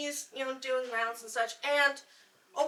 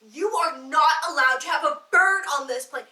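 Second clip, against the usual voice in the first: loud stern scolding; furious pilot laying down the law; angry captain; 8 dB louder than usual. Retold or imitated. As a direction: bark the rule at her. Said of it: "he is so mad. He's like".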